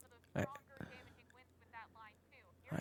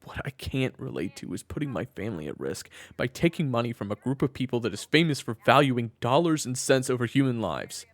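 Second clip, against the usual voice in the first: loud, higher, heated argument with his brother; heated objection; 6 semitones higher, 13 dB louder than usual. Acted. Brother angrily refusing a risky family deal, loud. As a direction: push back hard, voice rising.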